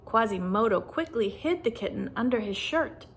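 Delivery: plain reading voice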